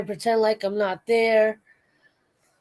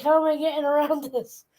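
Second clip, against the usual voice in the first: high-pitched worried cat voice; playful imitation, pitched well up; flustered cat; 8 semitones higher than usual. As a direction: voice a baffled cat, mock-flustered. Voicing cat baffled by the newcomer.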